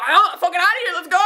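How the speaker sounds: retold burst of fan excitement; excited outburst. Fan spots Australis leaving and rushes out, excited outburst. Retold rush out on sighting them.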